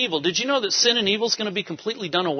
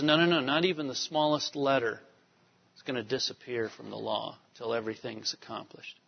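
softer, trailing quote of Jesus; quiet quote; 5 semitones lower, 8 dB quieter than usual. Retold or imitated. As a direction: let it drop away softly. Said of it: "Jesus says".

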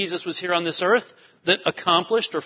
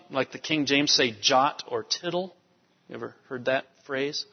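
ordinary talk

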